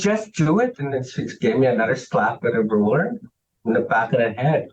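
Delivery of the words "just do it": high, snapped command from the nun; nun's sharp order. Retold or imitated. Nun ordering a crying child to obey, high sharp retold command. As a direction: bark it like an impatient nun. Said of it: "She goes".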